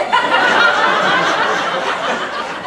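high loud laugh; big laugh. Real, not acted. Comic laughing at his own punchline, loud laugh. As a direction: crack up laughing.

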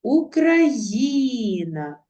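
Україна slow, stress on ї, the third syllable; stress on третій склад. Shown as a demonstration. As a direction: stretch Україна and lean on ї. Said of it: "правильно наголошуючи їх".

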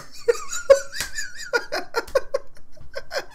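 a long squealing laugh; high helpless laugh. Real, not acted. Squealing laugh at the screen. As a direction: lose it completely.

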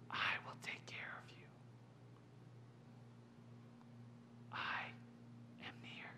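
soft, intimate whisper; whispering as God. Acted. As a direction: speak God's comfort in a bare whisper. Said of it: "he whispers".

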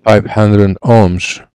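usual speaking voice

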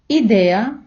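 ordinary delivery

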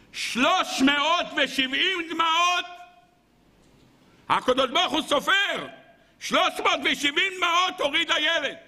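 very high, sustained proclamation; impassioned preaching cry. Real, not acted. Rabbi revealing the orphan's tears to listeners, crying it out. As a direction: cry out the count, pitch held high.

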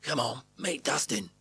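gruff, friendly urging. Acted. Bob taking Richard to meet Dustin, gruffly. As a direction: chivvy someone along in a gruff voice. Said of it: "while his voice gruffs".

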